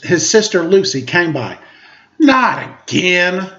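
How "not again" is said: slow, drawn-out complaint; complaining. Acted. Sister complaining to Linus over her shoulder, drawn out. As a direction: groan it out like a fed-up sister. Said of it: "Lucy complained over her shoulder".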